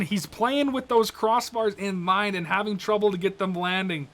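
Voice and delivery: normal talking voice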